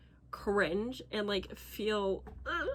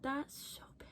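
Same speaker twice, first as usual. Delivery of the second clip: whispered longing; 4 semitones higher, 11 dB quieter than usual. Real, not acted. Wanting the bed.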